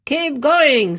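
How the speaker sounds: loud call to keep pulling; loud encouraging shout to the team; urging shout. Acted. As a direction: cheer them on, loud. Percy urging the rescuers on.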